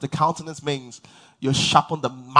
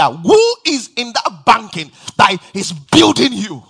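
louder, higher preacher's call to the congregation; preaching at full force; 7 semitones higher, 9 dB louder than usual. Acted. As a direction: preach it out, building fire.

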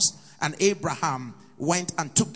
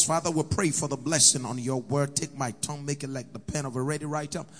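prayer; 3 semitones lower than usual. Acted. Preacher prays over the word.

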